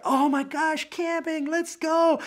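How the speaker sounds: high, shouted mock glee; excited. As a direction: burst out with over-the-top eagerness. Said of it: "and I'm excited".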